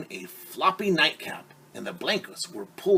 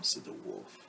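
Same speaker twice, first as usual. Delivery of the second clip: whispered aside; 12 dB quieter than usual. A narrator's reading. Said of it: "unvoiced aside".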